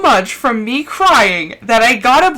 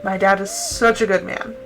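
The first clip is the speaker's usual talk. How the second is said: low, soft and tearful; choked-up tribute to dad; real crying; 4 semitones lower, 6 dB quieter than usual. Real, not acted. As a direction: say it quietly through real tears. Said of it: "Ugly crying".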